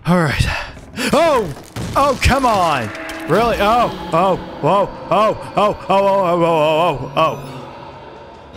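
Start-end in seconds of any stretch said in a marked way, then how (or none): 1.1-8.5 high frantic oh oh oh; frantic cries while fleeing; panicked yelps. Real, not acted. Panicked escape from the ghost.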